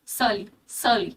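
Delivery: "surly, surly" slow and careful, R dropped, like "suh-lee"; silent R pronunciation. Shown as a demonstration. Teacher drilling the correct "surly" for students, slow and careful. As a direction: repeat "surly" slowly with no R sound, "səli, səli". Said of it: "R is kind of silent here".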